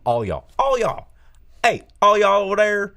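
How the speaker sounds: emphatic "all", rising to a holler; stress on ALL. Shown as a demonstration. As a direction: hit the "all" in "all y'all", building to a call. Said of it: "you need to reemphasize the all".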